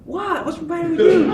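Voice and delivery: high-pitched imitation of the brother; mock innocent. Acts out brother playing innocent.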